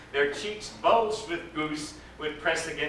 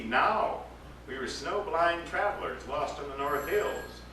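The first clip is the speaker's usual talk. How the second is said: plain reading voice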